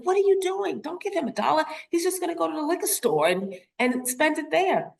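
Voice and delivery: put-on high, urgent teen protest; alarmed scolding. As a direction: stop dad in a shocked rush. Teen scolding dad about dollar.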